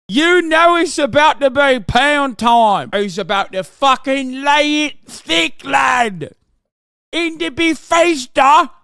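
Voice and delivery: mock British lad. Streamer mocking the Gamergate guy, in a hammed-up British lad voice.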